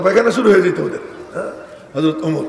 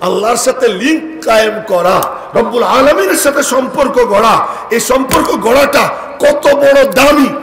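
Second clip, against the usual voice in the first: rising higher and louder to a peak; building preaching; 6 semitones higher, 5 dB louder than usual. Real, not acted. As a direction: build it to a passionate climax. Preaching climax. (আবেগে চড়তে থাকা ওয়াজ)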